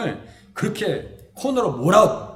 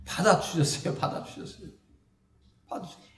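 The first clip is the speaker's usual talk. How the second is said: softer and softer, nearly whispered; fading repetition; 4 semitones lower, 7 dB quieter than usual. Real, not acted. Preacher assuring congregation, fading soft repetition. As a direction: let it fade away.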